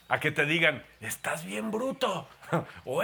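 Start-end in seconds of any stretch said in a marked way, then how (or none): 1.0-2.3 mocking partner. Partner's insult voiced.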